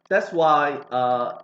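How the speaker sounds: ordinary talk, nothing marked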